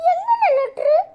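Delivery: laughing question. Acted. Laughs at the letter story.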